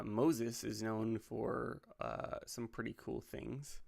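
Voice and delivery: usual speaking voice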